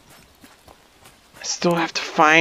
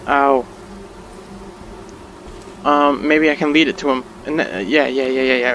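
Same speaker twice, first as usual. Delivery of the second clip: ordinary talk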